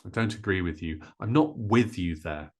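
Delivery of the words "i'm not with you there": "with" punched, "you there" falling away; stress on with. Shown as a demonstration. Teacher modelling the phrase for learners, stress on "with". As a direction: put the weight on "with". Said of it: "We would stress".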